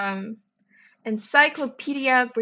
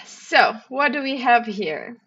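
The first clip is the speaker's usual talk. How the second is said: usual speaking voice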